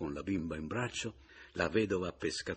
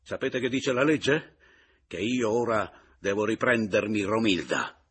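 forceful, loud legal challenge; loud threat; 6 dB louder than usual. Acted. Returned husband claims his wife back, loud and menacing.

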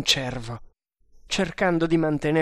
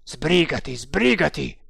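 impatient; 4 dB louder than usual. A narrator's reading. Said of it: "che non stava più fermo".

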